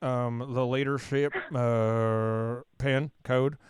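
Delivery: slow, low Oklahoma twang with awkward pauses; Oklahoma drawl with pauses. Shown as a demonstration. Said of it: "put those awkward pauses there".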